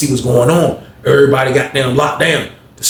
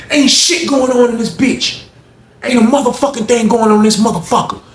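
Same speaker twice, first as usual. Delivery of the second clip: retold hostile snap; 8 semitones higher than usual. Honey bun man yelling at the booth officer, high defiant. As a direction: tell the officer off.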